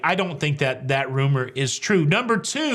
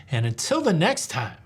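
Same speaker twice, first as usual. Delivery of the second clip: showy sign-off; 5 semitones higher than usual. Pretend. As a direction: swell upward and stretch the last word. Signature sign-off catchphrase.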